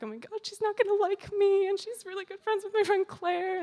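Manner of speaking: high, worried rush of inner panic; a mock-nervous thought said in a high voice; anxious inner voice. Inner panic about meeting Linda.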